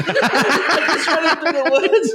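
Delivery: words squeezed out through laughter; real laughter breaking into the telling; laughing while telling. Real, not acted. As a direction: laugh through the line, slow.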